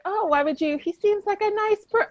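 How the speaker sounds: high-pitched, cooing naive objection; put-on high voice, sweetly protesting; Miss Buntwell. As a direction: lift it high, gushing in someone's defence. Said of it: "Miss Buntwell's like, all like".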